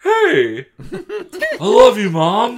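high, loud, gushing shout; over-the-top excited kid; exuberant son. Acted. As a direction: belt it out with big goofy affection. Boy's exuberant line in story.

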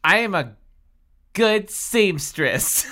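high, loud, slow announcement; theatrical, halting high delivery; hammed-up boast. A narrator's reading. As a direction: declare it proudly, word by word.

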